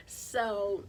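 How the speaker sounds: usual speaking voice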